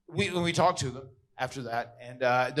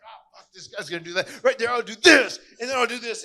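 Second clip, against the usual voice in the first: frustrated rant, high and building louder; imitating an exasperated wrestler; Razor Ramon; 8 semitones higher, 7 dB louder than usual. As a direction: fume through the plan in a raised voice. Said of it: "Razor Ramon's all frustrated".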